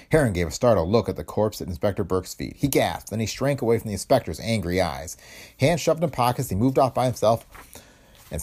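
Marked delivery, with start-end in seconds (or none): none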